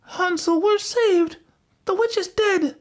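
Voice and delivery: put-on very high, excited girl's voice; joyful cry. A narrator's reading. Gretel freeing Hansel, high triumphant cry. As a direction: cry out the good news in a high child's voice. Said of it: "and cried".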